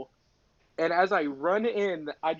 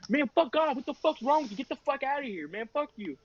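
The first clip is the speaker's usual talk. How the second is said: imitated angry outburst; 8 semitones higher than usual. Band kid to the apologizer, mock angry. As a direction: act out an indignant kid telling someone off.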